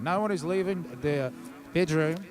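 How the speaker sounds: mock Australian leader's TV address, badly faked accent; botched Australian accent, measured like an official announcement; terrible Australian accent. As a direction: put on a silly Australian accent. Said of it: "I've got a terrible Australian accent".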